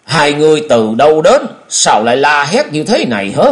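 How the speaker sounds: cao hơn, to hơn, gắt gỏng; quát hỏi kẻ lạ đang la hét; bực mình quát. A narrator's reading. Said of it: "ra vẻ bực mình rồi quát". (quát bực bội)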